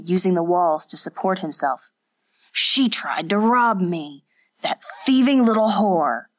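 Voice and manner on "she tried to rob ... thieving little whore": put-on slow, slurred drunk fisherman; drunken slurring. A narrator's reading. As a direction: slur it like a drunk. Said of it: "His words were so slurred".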